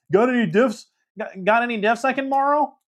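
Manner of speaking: high, teasing mock voice of a cocky friend; mocking Danta. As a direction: put on a high smug voice to needle him. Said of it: "And Danta goes".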